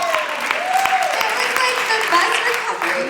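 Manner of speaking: high, loud, excited squeal; elated gushing. Real, not acted. Mother raves about the best recovery, elated gushing. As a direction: gush it out at the top of the voice.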